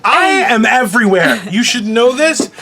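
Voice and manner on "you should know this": ordinary talk, nothing marked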